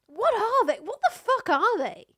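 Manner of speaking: high, loud, baffled outburst; incredulous. Real, not acted. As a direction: demand it in utter disbelief. Baffled that oysters lack brains.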